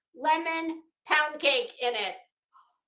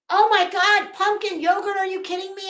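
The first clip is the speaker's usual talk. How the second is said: excited; 7 semitones higher, 7 dB louder, faster than usual. Real, not acted. Excited over pumpkin yogurt.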